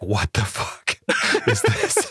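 slow, drawn-out incredulous question; wife's disbelief. Retold or imitated. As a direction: stretch it out like she can't believe the purchase. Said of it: "Christina's gonna be like".

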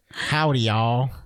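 brief demo of a Southern greeting; casual mock-Southern howdy; Southern accent. Shown as a demonstration. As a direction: do a light Southern twang. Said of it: "into Southern accent".

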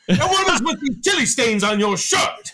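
high, loud indignant scolding; hammed-up outrage at a sloppy underling; mock dressing-down. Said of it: "dress him down quite literally, be like".

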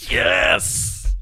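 yelled triumphant yes; excited shout. Real, not acted. Excited reveal of show name.

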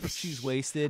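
normal talking voice

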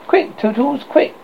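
high, mild mock scream; urgent cry. A narrator's reading. Tink screams at Tootles to hurry, an urgent cry. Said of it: "she screamed".

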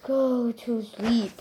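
slow, soft drowsy line; sleepy character imitation. Player showing how the brawler usually sounds, slow and soft. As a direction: imitate a sleepy game character, quiet and drawn out. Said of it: "Sonst ist er ja immer so".

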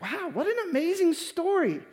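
high, swooping wowed reaction; mock amazement. Preacher voices the audience hearing the story, amazed. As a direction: gush it like an impressed listener.

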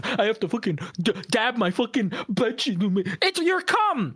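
high, heated rant; indignant rant. Real, not acted. Defending himself to friends, high heated rant. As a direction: rant at the friend who judged you.

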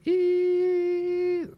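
a long, very high whine like ringing ears; ear ringing noise. Retold or imitated. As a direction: imitate the ringing after the blast. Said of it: "that ringing sound".

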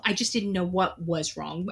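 ordinary talk, nothing marked

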